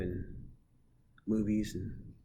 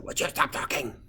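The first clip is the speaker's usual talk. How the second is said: raised, exasperated classroom scolding; louder, higher teacher telling-off; 5 semitones higher, 6 dB louder than usual. Acted. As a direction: call out sharply over a noisy class. Teacher scolding his imagined class.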